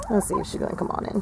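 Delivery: whispered aside. Real, not acted. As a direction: breathe it, no voice. Aside while coaxing the chicken.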